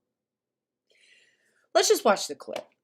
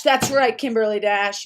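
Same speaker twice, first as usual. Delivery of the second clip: loud, punchy affirmation; ringing, emphatic endorsement of a viewer; emphatic agreement; 7 dB louder than usual. Real, not acted. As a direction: say it back much louder, with force.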